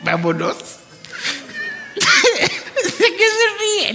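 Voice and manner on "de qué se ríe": slow question broken by laughter; laughing while asking. Real, not acted. Host cracking up at the man who laughs. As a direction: ask through real laughter.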